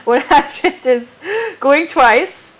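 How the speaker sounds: slow, drawn-out going twice; auctioneer call. Real, not acted.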